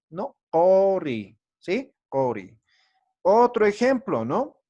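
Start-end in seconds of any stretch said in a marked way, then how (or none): 0.5-1.4 Cori with a long O after back Q; U lowered to O. Shown as a demonstration. Teacher repeating the model for students, Cori with long O. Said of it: "sino vamos a decir cori". 2.1-2.6 Cori with O in place of U; U lowered to O. Shown as a demonstration. Teacher repeating for students, Cori with O. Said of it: "sino vamos a decir cori".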